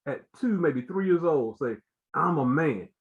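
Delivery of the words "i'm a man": lightly imitated boy acting manly; a hint of bass, playing a tough toddler; little boy putting on bass. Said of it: "a little bass in his voice".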